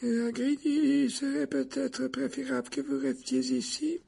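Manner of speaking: put-on very high, slow, hesitant old official; quavering voice. A narrator's reading. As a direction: quaver haltingly, pitched far up. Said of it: "la voix chevrotante du membre de la commission". (voix chevrotante, très aiguë et hésitante)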